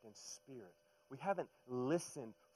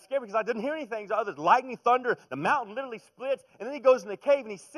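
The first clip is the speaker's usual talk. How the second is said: excited; 11 semitones higher, 14 dB louder than usual. Real, not acted. Retelling Elijah storm, building.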